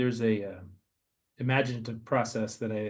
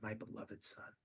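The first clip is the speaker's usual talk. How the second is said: hushed echo; 18 dB quieter, slower than usual. Acted. Echoing God's words softly.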